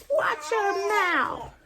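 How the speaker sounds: very high, slow mock mom warning. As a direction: drawl it out like a warning mother.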